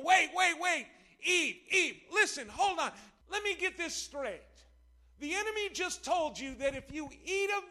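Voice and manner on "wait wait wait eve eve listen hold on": louder, higher urgent call; urgent interruption. Acted. Preacher calling out to stop Eve, urgent and loud. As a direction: cut in loudly to stop her.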